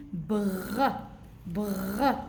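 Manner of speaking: "br" with the R drawn out; blended consonants. Shown as a demonstration. Teacher repeats for the children, blended consonants. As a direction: sound out "br" slowly as one blend. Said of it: "il faut juste savoir comment prononcer".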